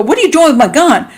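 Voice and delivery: mock alarmed confrontation; startled challenge. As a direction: play catching someone with your gun. Acted confrontation over the gun.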